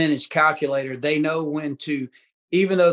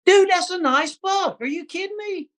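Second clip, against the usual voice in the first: amazed. Real, not acted. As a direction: stretch it out, really impressed. Seeing buck photo, amazed.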